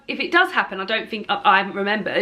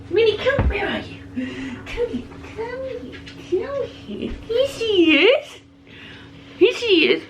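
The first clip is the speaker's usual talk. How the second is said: much higher coaxing pet voice; talking to the cat; 11 semitones higher, slower than usual. Real, not acted. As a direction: coax the cat over in a high sing-song, then greet her.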